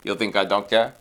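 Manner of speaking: put-on low, understated Portuguese-accented voice; Mourinho. Showing co-host his idea of Mourinho, low voice. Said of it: "my idea of Mourinho is just like".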